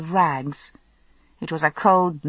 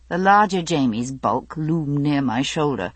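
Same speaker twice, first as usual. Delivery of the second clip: usual speaking voice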